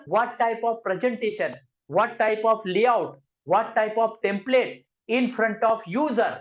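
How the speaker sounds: each English phrase stretched out slowly; slow dictation. Shown as a demonstration. Teacher slowly reciting the presentation definition to students. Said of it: "हमने क्या पढ़ा था sir?".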